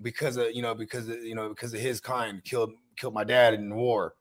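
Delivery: low mobster excuse. Retold or imitated. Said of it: "He's like".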